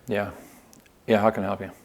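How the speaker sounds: low, bored worker greeting; half-hearted, can't-be-bothered "how can I help you"; unenthusiastic. Acted. Salesperson who doesn't want to be at work greets a customer, unenthusiastic. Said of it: "they're kinda like".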